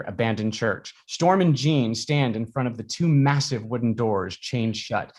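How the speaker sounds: ordinary delivery, nothing marked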